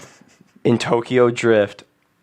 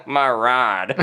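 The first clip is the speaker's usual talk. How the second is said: put-on off-camera heckler aping a Southern accent; mock the Southern vowel in "ride"; lightly parodied Southern twang. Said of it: "making fun of the way he talks".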